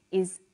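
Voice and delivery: "is" said as a short IZ, with a voiced z; final S as IZ. Shown as a demonstration. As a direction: sound out "is" as a separate IZ ending. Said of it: "first pronunciation of the final S".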